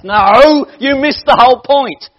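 a loud, high "No" that overrides the room; booming rebuke from the pulpit; emphatic correction. Real, not acted. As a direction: call them out sharply. Correcting the congregation firmly.

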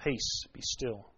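slow, soft, low command; a softly spoken calm command. Retold or imitated. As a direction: still the storm with a hush. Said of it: "when he calmed the sea".

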